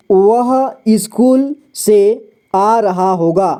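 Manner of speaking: very slow, with a pause after each word; measured teacher's model reading, one word at a time. Shown as a demonstration. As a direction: read it out like dictation for beginners. Teacher reading out the example sentence.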